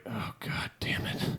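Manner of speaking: breathy, drawn-out groan of dismay; exasperated groan. Retold or imitated. Groaning to himself at the news. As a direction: sigh it out like it's the last straw.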